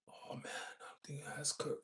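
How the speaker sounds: muttered under the breath, voiceless; a worried whisper to himself. Real, not acted. Looking for his dog's cup, a voiceless whisper.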